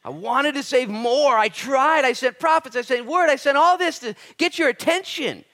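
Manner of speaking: loud, high, ringing sermon voice; full-force preaching. Acted. Preacher driving the point home to his congregation, loud and high. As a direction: preach it out to the whole room.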